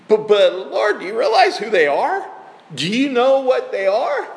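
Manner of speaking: a worried servant balking at the order; alarmed protest. Acted.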